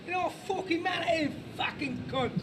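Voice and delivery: high-pitched Cockney street threat; Cockney accent. Shown as a demonstration. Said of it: "Here's Cockney".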